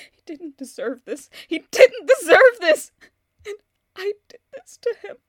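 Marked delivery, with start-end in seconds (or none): 0.1-1.3 low, hushed and broken; quiet grief. Acted. Grieving woman alone, quietly about her dead husband. Grieving widow, then outburst. 1.5-2.9 sudden loud, higher anguished yell; furious outburst. Acted. Grieving woman alone, yelling that Martin didn't deserve it.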